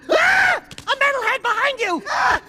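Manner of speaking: high, loud shriek of fake warning; mock alarm. Prankster scaring a jumpy guard, mock alarm. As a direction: scream a pretend warning to startle.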